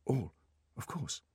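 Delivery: soft, high, meek compliance; apologetic assistant giving in; small, chastened voice; contrite. A narrator's reading. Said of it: "said the assistant contritely".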